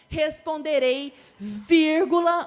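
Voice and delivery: "responderei, vírgula" slow and loud; slow loud emphasis. Shown as a demonstration. Teacher marking the comma slow and loud. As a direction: lean hard on "vírgula". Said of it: "antes da interjeição".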